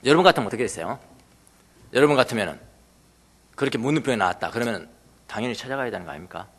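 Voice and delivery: ordinary talk, nothing marked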